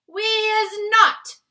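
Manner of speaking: slow, loud, high group shout; the giant gang shouting agreement; giants shouting together. A narrator's reading. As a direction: bellow it like a pack of giants. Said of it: "shouted the other giants".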